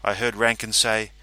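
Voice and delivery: ordinary delivery, nothing marked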